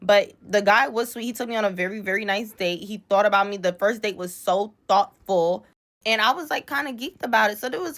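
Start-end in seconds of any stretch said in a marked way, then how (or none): none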